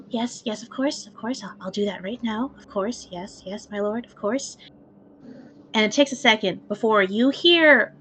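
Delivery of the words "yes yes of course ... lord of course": soft, meek servant murmuring yes; a timid servant bowing and scraping. Acted. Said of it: "like, meek voice".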